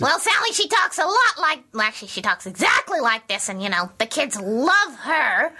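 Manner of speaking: put-on very high, loud, bouncy puppet girl voice; loud puppet Sally. Shown as a demonstration. Puppeteer showing the radio host Sally's voice, high and loud. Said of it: "what's her voice?".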